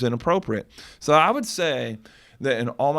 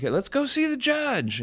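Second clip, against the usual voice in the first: retold yelling; 14 semitones higher, slower than usual. Calling back to the attorney to see the judge, retold yelling. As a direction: call back down the hall, high and slow. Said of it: "I'm yelling back".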